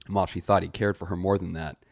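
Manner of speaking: plain reading voice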